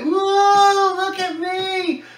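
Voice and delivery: high mock whoa, drawn out and slow; mock show-off. Mocking a reckless newcomer running in the tunnel, high and slow. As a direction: ham up a show-off kid on a lark.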